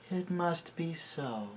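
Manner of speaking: soft, heavy and defeated; hopeless. A narrator's reading. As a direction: sound utterly resigned. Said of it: "in a heavy, hopeless tone".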